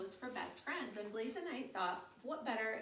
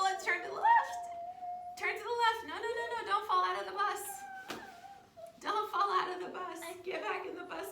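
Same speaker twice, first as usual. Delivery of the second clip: much higher and louder, playful game-leader energy; excited pretend bus ride; 8 semitones higher, 12 dB louder than usual. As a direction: call it out like a thrilling ride for a child. Pretend bus ride, turns, hills.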